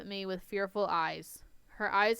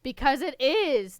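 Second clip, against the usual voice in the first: loud growl; 6 semitones higher, 10 dB louder than usual. Acted. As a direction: snap it back hard. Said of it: "Derek growled".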